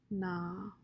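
soft, subdued sadness; a small, sad refusal. A narrator's reading. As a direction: say it sadly, very quietly. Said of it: "said Rob sadly".

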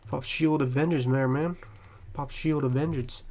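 normal talking voice

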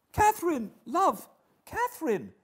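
very high, loud shocked appeal; aghast young man calling her name; shocked interjection. A narrator's reading. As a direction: interrupt in high-pitched dismay. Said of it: "greatly shocked at the double fault".

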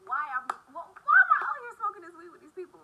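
high, loud frantic questions; loud panicked rant. Retold or imitated. Scolding herself as her friend chokes, loud and frantic.

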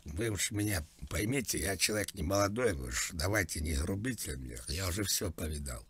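ordinary delivery

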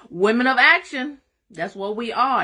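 rally the women with it; rallying cry. Real, not acted.

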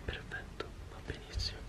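hushed whisper, setting up quietly. Real, not acted. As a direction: whisper so the sleeper stays asleep. Setting camera beside sleeping friend.

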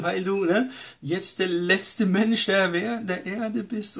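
Satan voice condemning a sinner; accusing Satan. Retold or imitated. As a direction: sneer the accusations from up high like the devil. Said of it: "sagt dann Satan".

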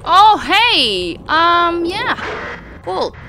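loud, high delighted oh hey; light up at a lucky find; excited discovery. Real, not acted. Finds item in room.

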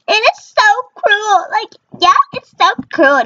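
high, whiny mock complaint; hammed-up high sulk; mock whiny lament. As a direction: whine it in a high pretend pout. Mock lament, pitch jumps up.

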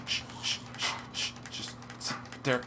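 voiceless shushing; hushing whisper. Real, not acted. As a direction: hush them in a whisper. Hushing during a tense fight.

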